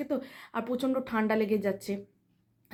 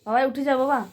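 loud, higher-pitched wake-up call; 4 semitones higher, 8 dB louder than usual. Real, not acted. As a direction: parent calling a sleeper to get up. Telling sleeping child to get up.

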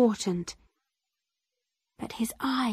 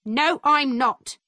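mildly angry, firm denial; indignant, emphatic refusal; 3 semitones higher, 3 dB louder than usual. A narrator's reading. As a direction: answer the accusation with a touch of anger. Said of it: "my mother answered angrily".

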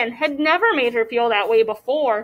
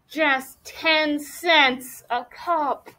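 very slow, drawn-out offer; slow sales pitch; 2 semitones higher, much slower than usual. Acted. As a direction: stretch out the bargain price. Closing line of the sales pitch.

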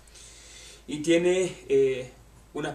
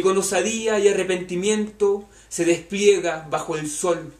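measured poetry reading, phrase by phrase; poem recitation; 2 semitones higher, 5 dB louder than usual. Acted. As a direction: recite it, raised above the chat. Reads a poem aloud.

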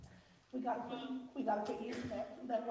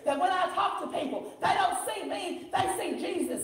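high, loud, drawn-out preaching; fervent pulpit delivery; full-force preaching; 7 semitones higher, 11 dB louder than usual. Real, not acted. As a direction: preach it out to the whole church.

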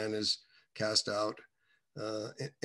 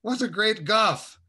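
confused question; 9 semitones higher, 7 dB louder, slower than usual. Retold or imitated. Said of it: "And I was like".

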